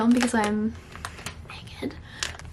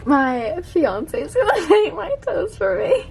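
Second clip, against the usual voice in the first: laughing; 7 semitones higher, slower than usual. Real, not acted. Giggling while being pampered.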